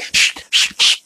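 sharp whispered shh, shh, shh; aggressive shushing. Retold or imitated. Flight attendant hushing the coach passengers, whispered shushing. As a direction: hush them hard, all breath and no voice. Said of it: "close the curtain all aggressive".

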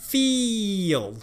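把 feel 的长 ee 音念清楚; clear feel. Shown as a demonstration. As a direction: feel 拉长长音 ee，和 fill 区分. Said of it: "feel和fill之间的差别". (示范 feel 的长音 ee)

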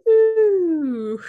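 high, loud woo; excited whoop. Retold or imitated. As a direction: whoop like riding a wave. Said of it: "you just go".

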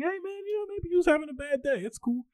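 breezy, shrug-it-off bro; chill forgiving dude. Retold or imitated. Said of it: "I'm like".

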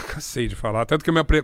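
usual speaking voice

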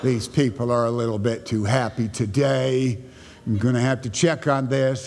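low-pitched mock disapproving churchgoer; upset church people. Preacher imitates church people upset at something great, low disapproving grumble. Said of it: "when they're upset because something great happens".

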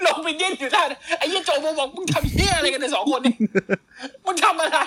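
amused and still cracking up; laughing while telling. Real, not acted. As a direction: laugh through the whole bit. Laughing through the cockroach story.

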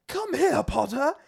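high, dramatic Quirrell yell; hammed-up villain command; dramatic villain shout. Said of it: "such a dramatic line read of Quirrell".

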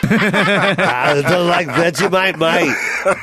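drawn-out hammed-up Aussie accent; Australian accent. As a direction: put on a broad Australian accent. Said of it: "better than your Australian accent".

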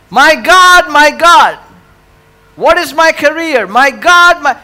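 loud, high-pitched pleading with God; crying out. Acted. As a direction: act out people wailing their questions to heaven. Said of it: "we go like this".

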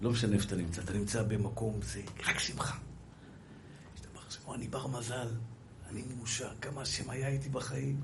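low, hushed reflection; quiet inward musing; soft contemplative preaching. Acted. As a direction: speak softly and low, as if counting blessings to yourself.

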